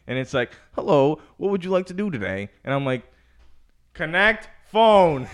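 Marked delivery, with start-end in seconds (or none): 0.7-2.5 automated voice. Retold or imitated. Car system voice. 3.9-5.3 slow, loud, drawn-out command; overenunciated command. Retold or imitated. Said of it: "I gotta scream everything".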